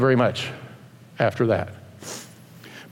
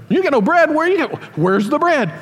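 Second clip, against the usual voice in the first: lightly voiced disbelieving disciple; incredulous disciple; 8 semitones higher than usual. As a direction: play it shocked, hammed-up.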